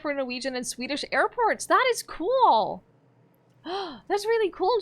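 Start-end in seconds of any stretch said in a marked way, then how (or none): 1.7-4.8 high and slow, gushing with amazement; delighted. Real, not acted. Streamer to chat about airport software, high and gushing. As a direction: gasp and gush over it.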